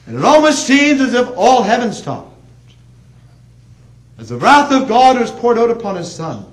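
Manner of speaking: full-force revival preaching; fiery preaching. Acted. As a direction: preach it with mounting force to the congregation. Preaching climax.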